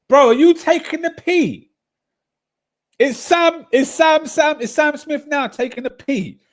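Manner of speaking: high mock-shocked squeal at Sam; incredulous squeal. Real, not acted. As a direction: shriek it like you can't believe it. Mocking outrage at Sam's claim.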